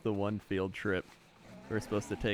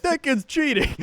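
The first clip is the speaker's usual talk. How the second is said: high, loud outraged yell; dad yelling; 8 dB louder, slower than usual. Retold or imitated. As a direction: shout it like an angry dad at the derby. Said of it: "like, yelled at it".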